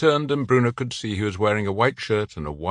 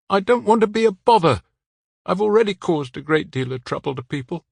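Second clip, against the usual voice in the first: much higher, louder, small and apologetic; he feels a burden; man with local French accent, despairing; 10 semitones higher, 4 dB louder than usual. A narrator's reading. Man on the ledge to Bruno, higher and louder, apologetic.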